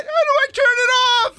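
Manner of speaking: hammed-up helpless cry at work; mock panic. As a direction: squeal the catchphrase as a joke. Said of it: "a machine would be going haywire".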